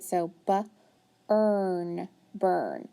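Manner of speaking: bu-, then earn, then burn blended together; teacher modelling blending for children; sounding out. Shown as a demonstration. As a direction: segment the word, then blend it. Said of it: "sound it out".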